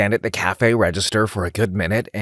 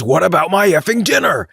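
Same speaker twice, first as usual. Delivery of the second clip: higher, louder indignant outburst; imitating a fed-up customer; mock exclaiming; 7 semitones higher, 4 dB louder than usual. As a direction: bark it like the irate caller. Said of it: "he exclaims".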